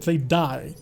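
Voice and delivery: normal talking voice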